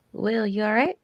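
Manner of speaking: character voice. Acted. Character calls back to Willow.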